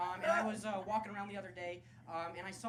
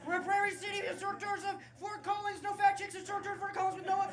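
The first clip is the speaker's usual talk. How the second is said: high, loud tour-guide patter; hawking historic tours in a raised, eager voice; 10 semitones higher, 5 dB louder than usual. Acted. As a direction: call out to a walking tour group. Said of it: "walking around being like".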